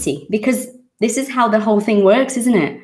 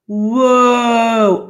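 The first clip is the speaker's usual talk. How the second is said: surprised whoa; 4 semitones higher, 4 dB louder than usual. Real, not acted. Amazed at son's question.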